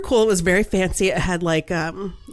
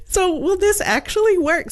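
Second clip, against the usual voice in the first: incredulous; 12 semitones higher than usual. Real, not acted. Doubting the car fits.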